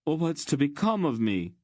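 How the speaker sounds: high, plaintive wail; tearful, high-pitched plea; crying out in despair. Acted. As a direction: wail it like someone abandoned. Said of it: "he cried".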